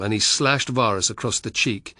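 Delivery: usual speaking voice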